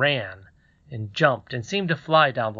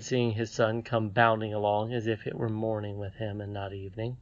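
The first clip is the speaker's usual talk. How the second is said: ordinary delivery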